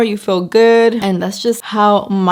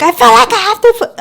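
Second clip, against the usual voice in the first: mock scream; 14 semitones higher, 5 dB louder, faster than usual. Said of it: "I wasn't, like, screaming that".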